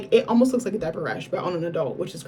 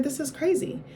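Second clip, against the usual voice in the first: much higher, hushed disbelief; 8 semitones higher, 3 dB quieter than usual. Retold or imitated. Recalling post-surgery helplessness to viewers, high incredulous disbelief. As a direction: marvel that you can't even walk alone. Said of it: "And I was like".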